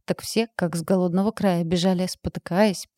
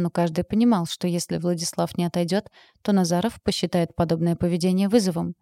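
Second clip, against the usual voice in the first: usual speaking voice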